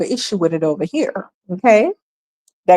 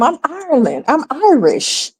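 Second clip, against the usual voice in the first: mock answer; 6 semitones higher than usual. Vlogger mocking an immigrant claiming to be Irish, higher and fumbling. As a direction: play a flustered immigrant correcting himself. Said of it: "Same thing in Ireland".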